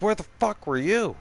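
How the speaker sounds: imitating his ex snapping at him; angry yell. Ex yelling at him for coming home late, angry shout. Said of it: "she's all, all pissed off".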